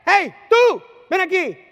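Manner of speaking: high, booming shouts from afar; mock officer yell. Officers spot someone on the grass, imitated shout. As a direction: bellow short commands, pitched right up. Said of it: "desde que no sé dónde".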